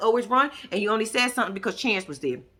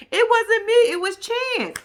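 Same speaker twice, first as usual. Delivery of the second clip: mock Taylor excuse; 11 semitones higher, 4 dB louder, slower than usual.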